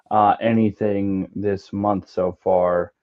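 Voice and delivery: normal talking voice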